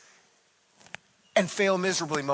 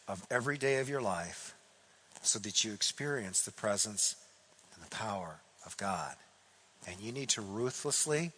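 very calm; 6 semitones lower, 9 dB quieter than usual. Retold or imitated. Old mentor quietly counsels the frustrated pastor, calm and soft. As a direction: settle back and let each phrase land gently. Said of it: "He sits back and he very calmly says".

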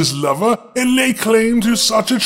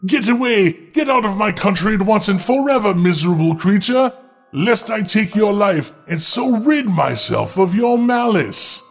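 ordinary delivery

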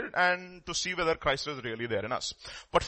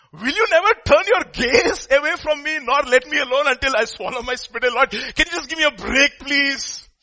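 high, loud exasperated pleading; crying out; 9 semitones higher, 9 dB louder than usual. Acted. Job begs the Lord for a break, acted outcry. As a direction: plead loudly at a raised pitch. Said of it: "he's, like, crying out".

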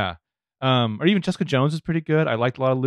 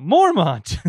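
higher, louder mock yell; retold yell; 7 semitones higher, 5 dB louder than usual. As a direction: call it out like a sudden moment of recognition. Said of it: "I'm just yell out".

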